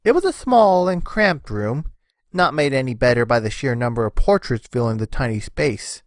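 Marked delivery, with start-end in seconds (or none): none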